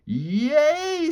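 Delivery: very high shrieked cry; high squealing scream; Leslie screaming. A narrator's reading. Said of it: "screamed Leslie".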